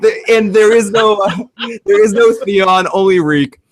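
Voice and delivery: laughing through quote. Retold or imitated. Giggling through retold line.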